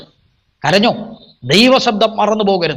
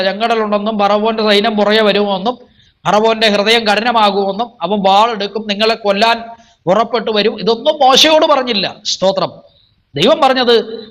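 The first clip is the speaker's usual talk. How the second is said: ordinary delivery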